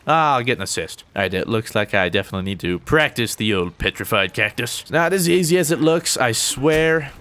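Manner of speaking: ordinary talk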